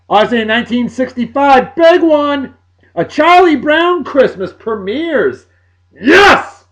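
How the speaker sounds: high, loud, building to a shouted yes; excited announcement. Real, not acted. Host announcing a beloved Christmas special's anniversary, excited. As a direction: proclaim it big, stretching the title.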